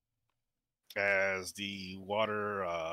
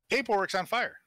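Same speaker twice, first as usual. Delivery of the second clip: sudden alarm; 12 semitones higher, 6 dB louder than usual. Acted. GM alerts player the desk is burning, excited. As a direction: pitch jumps up, louder, urgent.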